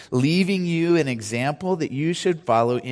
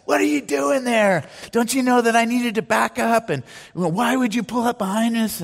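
retold yelling; 9 semitones higher than usual. Angry driver in a hotel lot yelling at the pastor's family: high mock-angry yell. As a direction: act out the driver's angry outburst, pitched way up. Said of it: "he just starts yelling at us".

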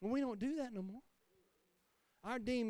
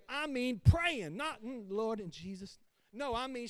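usual speaking voice

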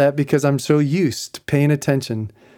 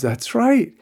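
sudden realization; 10 semitones higher than usual. Retold or imitated. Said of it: "She goes".

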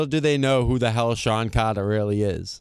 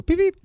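high squeaky beep, beep; much higher mock beeps; pager beep imitation; 4 dB louder than usual. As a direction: chirp like a pager.

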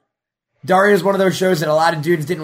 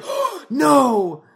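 higher, louder pleading; pleading crowd; 9 semitones higher, 5 dB louder, much slower than usual. Retold or imitated. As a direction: beg him to say it. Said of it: "everyone will be like".

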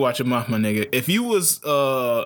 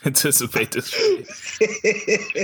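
slow, higher, laughing repeat; 5 semitones higher, much slower than usual. Real, not acted. Repeating the terrible fade pun to friends, laughing. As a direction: draw it out through laughter.

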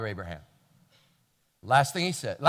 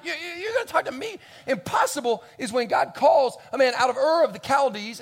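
loud, high, crying-out protest; shouting Abraham; 7 semitones higher, 5 dB louder than usual. Retold or imitated. Old Abraham shouting at the servant about God's call. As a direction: shout your whole life story back. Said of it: "old Abraham".

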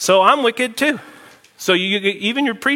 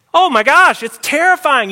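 higher, louder oh my gosh; worried parent exclaiming about screens; alarmed; 8 semitones higher, 6 dB louder than usual. Real, not acted. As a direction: sound genuinely alarmed. Alarm over children's screens.

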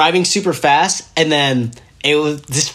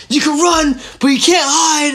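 high, hammed-up yell of a chasing cop; movie-cop shout; 13 semitones higher, 3 dB louder than usual. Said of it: "straight out of the fucking movie".

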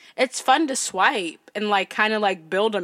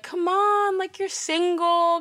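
mock pushy friends; 9 semitones higher than usual. Friends urging her onto Tinder.

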